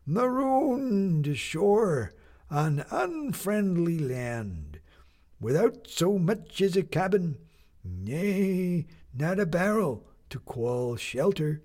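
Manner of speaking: a doddering captain addressing his shipmates; creaking old sea captain. Acted. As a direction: creak out each word like old ship timbers. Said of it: "in a voice that creaked like timbers".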